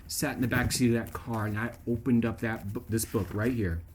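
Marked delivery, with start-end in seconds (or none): none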